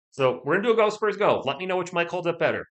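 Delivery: ordinary talk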